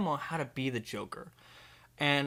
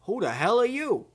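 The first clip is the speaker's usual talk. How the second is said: indignant challenge; 7 semitones higher than usual. Acted. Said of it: "He goes".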